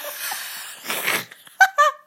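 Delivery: high, loud burst of laughter. Real, not acted. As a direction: crack up helplessly mid-sentence. Breaks up during shout-outs.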